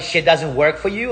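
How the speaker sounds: ordinary delivery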